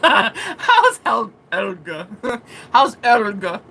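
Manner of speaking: words broken up by giggles; real amusement bubbling through the question; laughing. Real, not acted. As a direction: repeat the joke while cracking up. Repeats joke while laughing.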